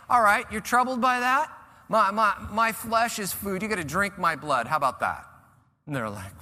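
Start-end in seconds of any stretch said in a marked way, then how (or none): 0.1-5.2 louder, higher, daring taunt; provocative challenge. Retold or imitated. Jesus dares the troubled crowd, provocative taunt. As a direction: push their buttons on purpose. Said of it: "he's not gonna let them off".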